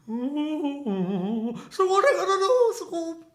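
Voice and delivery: very high, slow cartoon blend. Doing all three impressions at once, silly high voice. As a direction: draw it out high and loud, laughing. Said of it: "so all three, so it's like".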